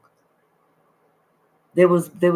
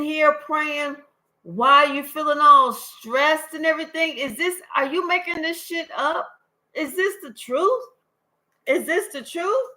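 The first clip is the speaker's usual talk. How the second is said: incredulous scolding; 6 semitones higher than usual. Acted. Spirit reader scolding indecisive querent.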